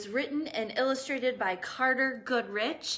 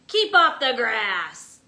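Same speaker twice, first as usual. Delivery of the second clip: much louder grumpy order; shout; 12 dB louder than usual. Acted. Cactus shouting at a passing cowboy. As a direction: bark at the trespasser. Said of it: "shouted Hank".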